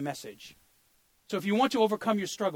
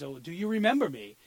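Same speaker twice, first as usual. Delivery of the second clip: usual speaking voice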